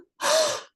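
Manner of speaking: high sharp gasp; startled high gasp; surprised gasp. Acted. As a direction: gasp at what you see. Jack sees robbers.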